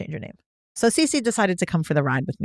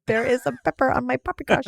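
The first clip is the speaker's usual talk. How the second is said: mock posh diner; 6 semitones higher than usual. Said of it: "pecan pie".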